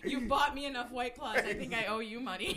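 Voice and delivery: loud and laughing. Real, not acted. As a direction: laugh through the words. Laughing banter.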